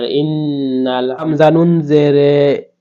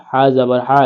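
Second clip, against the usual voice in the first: ordinary talk, nothing marked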